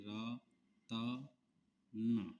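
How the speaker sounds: usual speaking voice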